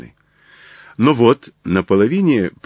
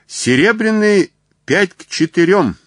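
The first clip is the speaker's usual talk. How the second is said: high-pitched, louder racecourse cry; bookmaker's cry carried across the grandstand; shout from the stands; 11 semitones higher, 4 dB louder than usual. A narrator's reading. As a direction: call the odds out over a crowd. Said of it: "неслось с трибун".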